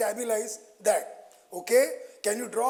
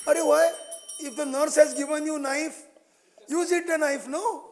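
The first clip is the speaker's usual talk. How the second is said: high, loud, incredulous questioning; exasperated high challenge; 7 semitones higher, 4 dB louder than usual. Real, not acted. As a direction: push back hard, raising the pitch. Teacher challenges a student's answer.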